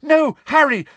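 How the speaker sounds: high, loud desperate plea; frantic girl's cry; cracked shout. A narrator's reading. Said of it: "shouted Hermione in a cracked voice".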